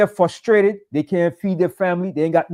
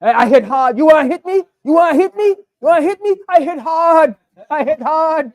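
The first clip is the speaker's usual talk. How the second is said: cursing defiance; 9 semitones higher than usual. Retold or imitated. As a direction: dare them, climbing higher each time. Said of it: "She was cursing the hell out of them".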